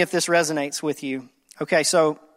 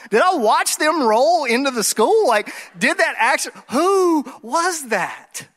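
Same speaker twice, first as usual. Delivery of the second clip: stunned self-questioning; 6 semitones higher than usual. Retold or imitated. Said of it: "you sitting there and you go".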